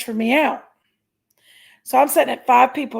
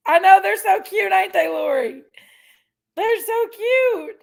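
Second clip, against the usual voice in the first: gushing; 6 semitones higher than usual. Real, not acted. Cooing over the kids.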